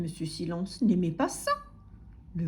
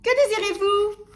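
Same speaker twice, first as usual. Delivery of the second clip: bellowing grocer; 11 semitones higher, 8 dB louder than usual. A narrator's reading. Grocer greeting timid customer, yelling. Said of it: "hurlait l'épicier".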